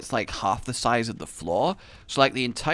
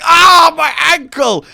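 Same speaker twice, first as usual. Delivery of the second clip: high, loud, drawn-out cry; hammed-up agonised shout; mock pained yell; 14 dB louder, slower than usual. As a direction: wail as if the ankle just twisted. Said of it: "I'm like".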